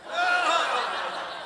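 a high, soft burst of real laughter. Real, not acted. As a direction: laugh out in disbelief, softly. Laughs at marriage demand.

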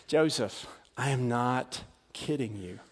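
Mary to Joseph, low and serious; hushed insistence. Acted. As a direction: press it quietly so he believes.

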